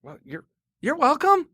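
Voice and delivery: high, smug you're welcome; sarcastic high. Retold or imitated. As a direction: sweetly needle her with it.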